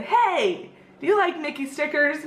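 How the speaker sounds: put-on high, sing-song question for a little kid; retold perky cast member greeting; friendly to a child. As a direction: greet a small boy with lifted voice. Acted greeting to a little boy.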